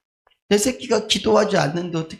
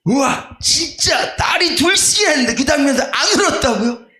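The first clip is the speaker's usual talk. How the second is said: imitating a shocked pastor, pitched up; retold incredulous outburst, higher and a bit louder; mock astonished; 11 semitones higher, 3 dB louder than usual. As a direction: act out the colleague's disbelief. Retelling pastor's shocked question.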